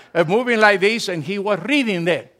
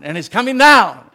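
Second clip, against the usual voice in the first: proclaimed louder, with force; emphatic repeat louder; 4 semitones higher, 10 dB louder than usual. Shown as a demonstration.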